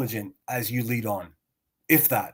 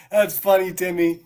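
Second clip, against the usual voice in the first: loud, higher mocking laugh into the words; sarcastic laughing; 6 semitones higher, 4 dB louder than usual. Real, not acted. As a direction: laugh him down, scornfully.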